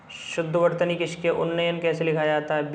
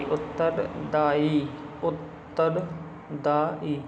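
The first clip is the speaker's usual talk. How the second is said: slow drawn-out repetition; much slower than usual. Shown as a demonstration. Teacher drilling the class on a word, slow and drawn out. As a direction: stretch उत्तरदायी out slowly, letting it fade.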